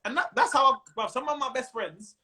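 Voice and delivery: ordinary delivery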